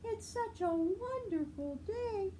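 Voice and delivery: high, sing-song cheer; cheerful Marabou. Acted. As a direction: gush over the day up high. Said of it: "asked Marabou".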